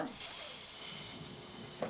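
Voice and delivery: long voiceless hiss on "S"; pure S sound. Shown as a demonstration. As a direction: say "S" as the sound, a sustained sss. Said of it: "le son, on le dit comment?".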